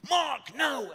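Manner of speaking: retold scream. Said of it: "suddenly I get a scream".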